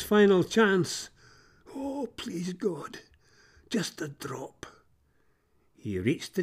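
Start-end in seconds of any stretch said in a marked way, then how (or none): 1.8-4.7 a thirsty crow whispering to God; whispered plea. Acted. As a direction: beg under your breath. Crow begs for a drop.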